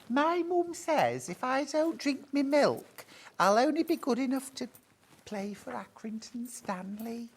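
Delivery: put-on high-pitched Scouse kid from an advert; mock Scouse advert. Host re-enacting an old milk advert, high mock Scouse. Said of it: "Do you remember that advert?".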